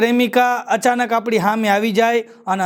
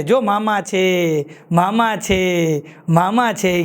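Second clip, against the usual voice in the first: slow drawn-out cover-up; 4 semitones lower, 5 dB quieter, much slower than usual. Retold or imitated. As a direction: drag out મામા છે each time. Mother covering for old lover.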